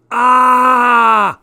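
sharp high yell of pain; loud cry of sudden pain. A narrator's reading. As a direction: yelp as the pain hits. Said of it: "I moaned".

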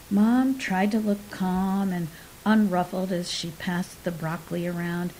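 plain reading voice

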